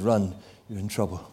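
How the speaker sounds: usual speaking voice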